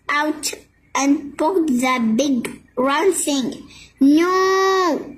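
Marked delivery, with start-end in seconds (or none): 4.0-5.1 a mild yell, pitched higher; yelling crowd. A narrator's reading. Crowd yells at Pip poking the thing: mild yell. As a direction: cry no as the crowd. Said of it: "the crowd yelled".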